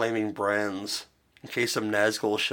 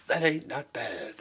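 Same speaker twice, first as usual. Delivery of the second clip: slower, higher movie quote; movie line redone; 5 semitones higher, slower than usual. Retold or imitated. Podcaster correcting his own movie quote for friends, a slow higher imitation. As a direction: redo the film line properly, drawn out. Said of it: "gotta, gotta say the line right".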